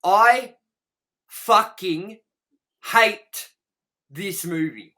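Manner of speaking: every word punched out separately, with long pauses; loud, word-by-word verdict, easing at the end; emphatic declaration. Real, not acted. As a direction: hammer each word on its own. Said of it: "just gonna say it like this".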